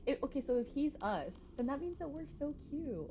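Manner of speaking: high excited gush. Real, not acted. Streamer gushing at chat about looking cute, high excited. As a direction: squeal it out, delighted. Excited realization at game twist.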